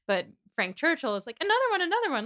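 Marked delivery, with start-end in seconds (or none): 1.4-2.3 high, excited mock pleading; eager urging. Frank pushing Jane to sing again, eager urging. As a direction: pitch it up like an overkeen party guest. Frank begging for another song.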